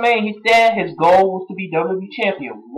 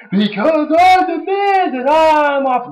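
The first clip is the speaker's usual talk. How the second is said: The Miz; 9 semitones higher, slower than usual. Retold or imitated. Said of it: "Miz said".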